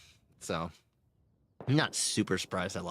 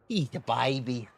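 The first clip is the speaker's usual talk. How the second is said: ordinary talk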